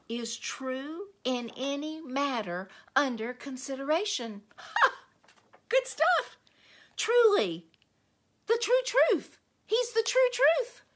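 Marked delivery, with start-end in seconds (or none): 4.6-10.8 much higher, louder delighted exclamations; excited. Real, not acted. Preacher thrilled by what the Greek word for truth means. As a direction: exclaim with rising joy.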